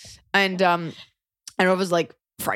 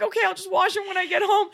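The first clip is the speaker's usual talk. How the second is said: resigned panic; 13 semitones higher, faster than usual. Retold or imitated.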